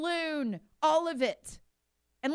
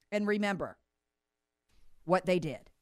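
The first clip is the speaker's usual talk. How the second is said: ordinary talk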